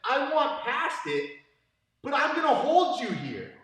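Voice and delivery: mock the student's silly logic; mocking imitation. Coach mocking how students hold the hook, high mock shout. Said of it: "who gives somebody a hook and says".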